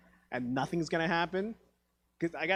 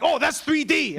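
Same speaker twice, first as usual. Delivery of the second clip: dad's excuse; 11 semitones higher, 8 dB louder, slower than usual. Retold or imitated.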